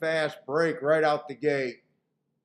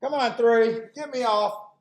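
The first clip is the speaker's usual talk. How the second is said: excited cheering; 4 semitones higher than usual. Real, not acted. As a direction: cheer the horse home. Cheering horse during race.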